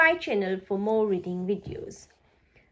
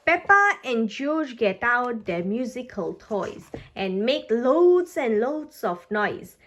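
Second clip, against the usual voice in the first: ordinary delivery, nothing marked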